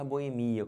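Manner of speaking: "boemia" as bo-e-MI-a; wrong stress on MI. Shown as a demonstration. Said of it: "Não pode falar". ("boemia" errado, tônica no MI)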